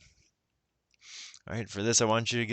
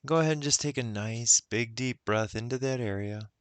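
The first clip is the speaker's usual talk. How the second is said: unhurried guided meditation voice; 2 semitones higher than usual. Acted. Guide leading listeners into self-love, guided meditation. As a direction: ease the listener inward, with long pauses.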